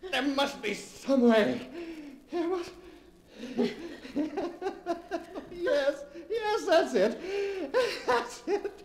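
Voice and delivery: rising to a high, laughing triumph; an idea dawns, giddy and manic; sudden excitement. Acted. Trapped husband finds a way out, laughing triumph.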